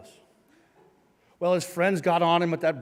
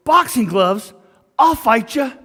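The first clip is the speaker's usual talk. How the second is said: loud, higher-pitched call-out; shouted challenge; 6 semitones higher, 11 dB louder than usual. Retold or imitated. Football player calls out boxer from weight room, shouted challenge. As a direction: holler it across the room as a dare.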